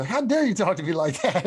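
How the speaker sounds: high, loud mock outrage; indignant fake voice. Friend retells a prank caller's indignant outrage. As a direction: ham up an offended caller's fake voice. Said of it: "he tries to do this fake voice".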